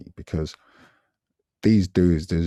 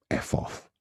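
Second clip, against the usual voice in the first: hushed F off; 7 dB quieter than usual. Retold or imitated. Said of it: "he was basically saying".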